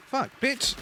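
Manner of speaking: high startled curse; startled yelp. Real, not acted. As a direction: yelp it in sudden fright. Jump scare reaction.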